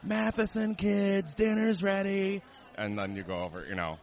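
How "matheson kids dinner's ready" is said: high, drawn-out mock holler; imitated scream. Host cousin calling waiting diners to the table, mock screaming. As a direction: imitate calling kids in from far off, very high and slow. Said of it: "scream at the top of their lungs".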